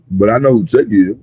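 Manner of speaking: ordinary delivery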